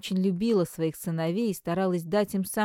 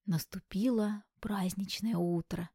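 whisper; 4 dB quieter than usual. A narrator's reading. Narrator whispers scene opening.

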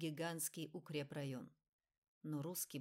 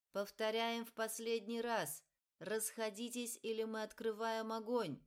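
higher, louder stern warning; 5 semitones higher, 4 dB louder than usual. Acted. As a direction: bark the final order. Megaphone warning before firing. (громкое последнее предупреждение)

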